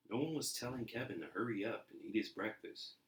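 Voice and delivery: ordinary delivery, nothing marked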